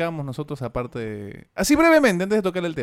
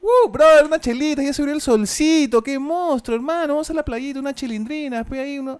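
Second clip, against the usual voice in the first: much higher, excited beach bro; a hyped guy craving beer and beach, pitched way up; beach-loving bro impression; 10 semitones higher, 5 dB louder than usual. Mimicking summer-loving beach bros, high and hyped. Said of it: "una imitación de la gente que ama el verano".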